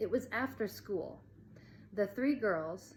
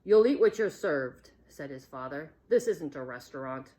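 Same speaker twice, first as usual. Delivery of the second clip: stern father; 4 semitones lower, 8 dB louder than usual. A narrator's reading. Stern father scolds son.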